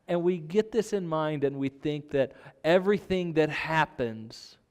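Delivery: ordinary talk, nothing marked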